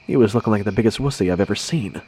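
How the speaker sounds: ordinary delivery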